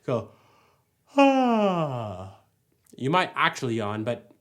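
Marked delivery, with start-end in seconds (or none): 1.2-2.3 sigh. Shown as a demonstration. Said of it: "Try just to do a sigh".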